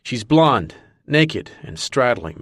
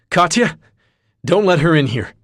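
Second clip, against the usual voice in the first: panicked shout; 8 semitones higher, 6 dB louder than usual. Acted. Drunk Marcus panicking, shouting.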